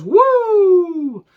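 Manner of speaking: loud, sustained high-pitched whoop; high drawn-out woo. Acted. Podcaster riffing on Ric Flair, long high woo. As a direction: let out a long, ringing wrestler's "woo".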